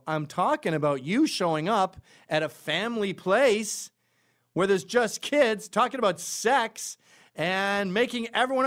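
high, loud public accusation; loud confrontation. Acted. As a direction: confront someone head-on, punching out each phrase.

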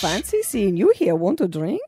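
botched Irish that sounds Russian; attempted Irish accent. Shown as a demonstration. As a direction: have a bad go at an Irish accent. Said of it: "That was Russian".

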